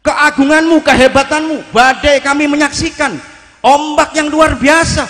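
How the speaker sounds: preacher voicing the saint's prayer at full force; loud proclaiming prayer. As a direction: proclaim it over the roar of the storm. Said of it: "Ini kata dia".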